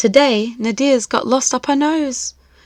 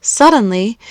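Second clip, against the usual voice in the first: ordinary delivery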